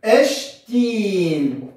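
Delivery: اشتين drawn out very slowly, each sound clear; slow word demo. Shown as a demonstration. Teacher models the Coptic word for students, slowly drawn out. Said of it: "مثلًا هقول ايه؟".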